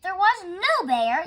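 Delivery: loud, high, slow yell. A narrator's reading. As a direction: bellow it out in frustration. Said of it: "yelled Mister Rogers".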